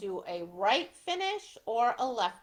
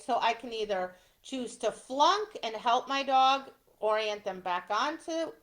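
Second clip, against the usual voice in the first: ordinary talk, nothing marked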